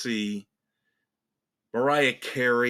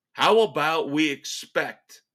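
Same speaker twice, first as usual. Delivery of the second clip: ordinary delivery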